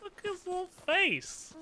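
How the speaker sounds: high squeaky cooing; overwhelmed by cuteness. Real, not acted. Cooing over cute monster, higher.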